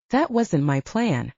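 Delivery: usual speaking voice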